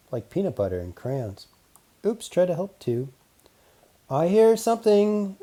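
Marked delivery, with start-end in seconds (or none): 4.1-5.4 robot man voice. Acted. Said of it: "Robot Man said suddenly".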